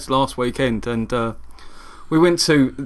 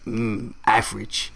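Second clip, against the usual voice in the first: mock advert delivery; 6 semitones lower, 7 dB quieter, slower than usual. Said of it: "turning to the screen going".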